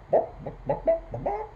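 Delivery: high, staccato bok-boks; hen clucking. A narrator's reading. Storyteller voicing the silly old hen, clucking. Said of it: "He heard the old hen clucking".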